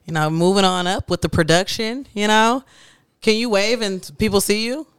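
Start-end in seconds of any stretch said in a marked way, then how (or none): none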